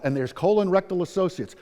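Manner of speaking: ordinary delivery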